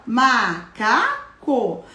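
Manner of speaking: macaco stretched out very slowly; teacher sounding out macaco slowly for children; slow sounded-out word. Shown as a demonstration. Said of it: "Ficou como agora?".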